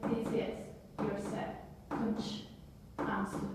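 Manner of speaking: This is your set, kunch, ans tu read in short chunks with a breath between; paused reading. Shown as a demonstration. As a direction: read each chunk, then pause like a heartbeat. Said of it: "tried to stop and take a breath".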